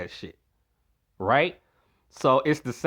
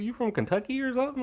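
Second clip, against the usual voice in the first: fast, higher teasing jab; 9 semitones higher, 6 dB quieter, faster than usual. Real, not acted. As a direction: rib him quickly. Teasing friend, fast high.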